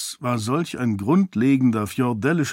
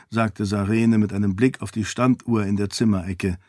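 usual speaking voice

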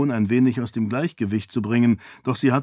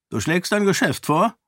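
higher, probing question; the merchant king sniffs a deal; wary king; 8 semitones higher than usual. Acted. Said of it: "erkundigte sich der König". (misstrauische Nachfrage)

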